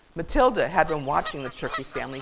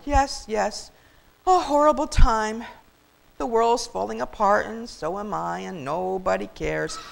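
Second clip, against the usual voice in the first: woeful self-pity; 4 semitones higher, 5 dB louder than usual. Acted. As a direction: lament as if the world is ending. Turkey wails self-pity.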